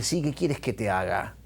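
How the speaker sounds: soft, tender question to the blind man; softly, kindly, as if leaning in; Jesus answers the blind beggar quietly and tenderly; gentle Jesus. Retold or imitated. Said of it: "el Señor se da vuelta y le dice".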